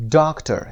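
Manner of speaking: "Doctor" with DOC high; stress on first syllable. Shown as a demonstration. Teacher shows students the stressed DOC in "Doctor". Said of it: "قسمت اول".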